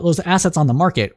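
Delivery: normal talking voice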